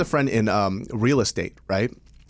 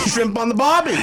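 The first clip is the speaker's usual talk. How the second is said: high, hammed-up Aussie catchphrase; mock Australian accent; 10 semitones higher, 4 dB louder than usual. Co-host joining the bit, high mock Australian accent. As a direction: chime in with a cartoon Australian accent. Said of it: "That's a weird accent you're doing".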